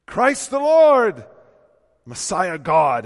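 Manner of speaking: loud, high, ringing preacher's climb; full-voiced pulpit proclamation; preaching at full force. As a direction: lift it up and proclaim.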